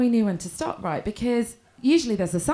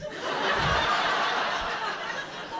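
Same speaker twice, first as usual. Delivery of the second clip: breathy high laugh; 11 semitones higher, 6 dB quieter than usual. Real, not acted. Laughter after punchline.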